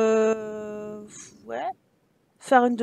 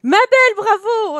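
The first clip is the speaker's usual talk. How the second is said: loud, high-pitched bravo; congratulatory cheer; 8 semitones higher, 7 dB louder than usual. Real, not acted. Player congratulating the character, loud excited cheer. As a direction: call out congratulations at full pitch.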